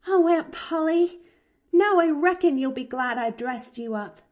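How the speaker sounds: put-on high, loud girlish delight; exulting. A narrator's reading. Girl admiring her dressed-up aunt, exulting.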